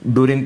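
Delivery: डूरिंक with an "u" between d and r; wrong added vowel. Shown as a demonstration. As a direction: say डूरिंक as "du-rink". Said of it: "तो खैर कोई नहीं पढ़ेगा".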